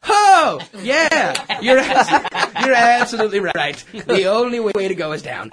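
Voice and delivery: high, loud nervous laugh; nervous mock agreement. Answering the man's joke about falling, nervous high laugh. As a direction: force a startled laugh and agree.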